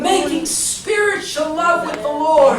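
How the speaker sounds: high, insistent pulpit cry; impassioned preaching. Real, not acted. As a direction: drive the repeated phrase home, stretching the key words. Preaches at full force.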